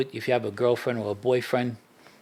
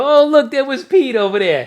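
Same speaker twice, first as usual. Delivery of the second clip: loud, much higher mock call; 12 semitones higher, 10 dB louder than usual. Friends mocking Pete's chalk outline, loud high jeer. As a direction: point and jeer like a kid teasing. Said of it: "we used to go in there and go".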